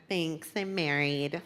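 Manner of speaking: slow, soft, dry brush-off; curt brush-off. Retold or imitated. Woman turning down a guy, slow dry brush-off. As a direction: shut him down sweetly but flat. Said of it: "And I went".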